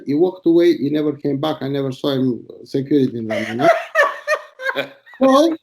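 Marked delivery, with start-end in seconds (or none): 3.7-4.9 bursts out in a high cackle; real burst of laughter. Real, not acted. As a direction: crack up at your own story. Laughing at own prank story.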